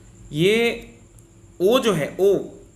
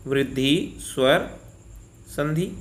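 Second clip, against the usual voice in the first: वृद्धि स्वर संधि said slowly, word by word; slow dictation-style recap; slow and deliberate; 3 semitones lower, slower than usual. Shown as a demonstration. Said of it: "very से हो गया".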